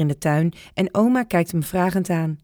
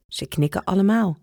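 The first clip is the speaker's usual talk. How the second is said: usual speaking voice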